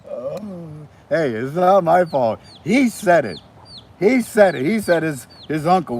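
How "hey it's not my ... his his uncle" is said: louder and higher, it's not my fault; loud defensive protest. Real, not acted. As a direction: push back hard, pointing at him. Denying blame, raising voice.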